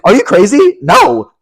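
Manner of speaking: high, loud imitation of outrage; mock indignant refusal. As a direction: refuse as if the request is absurd. Retells celebrity refusing a TikTok.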